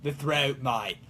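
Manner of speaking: put-on low Australian-accented aside; Italian accent collapsing into Australian; slipping into Australian accent. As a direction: drop deep into mock Aussie. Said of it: "you talk a little Australian like that".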